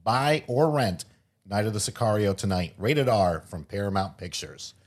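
upbeat sponsor-ad voice; ad read. Acted. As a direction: read it like a movie trailer spot. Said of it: "shout out to one of our sponsors".

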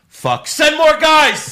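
loud, high, drawn-out yell; mock frantic shout. Show producer watching the rival show, mock-panicked yell. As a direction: bellow for backup in comic panic.

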